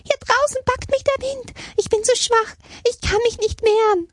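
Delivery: very high, pleading fawn voice; begging little deer. A narrator's reading. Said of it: "bettelte ein kleines Reh". (flehende, hohe Rehstimme)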